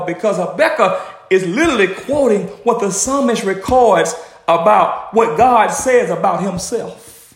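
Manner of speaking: ordinary talk, nothing marked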